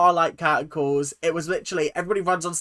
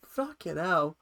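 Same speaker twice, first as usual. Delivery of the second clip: ordinary talk, nothing marked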